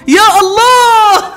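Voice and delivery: high, drawn-out loud groan of despair; anguished cry. Real, not acted. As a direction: wail at the stuck ball. Ball barely moves. (صرخة يأس ممدودة)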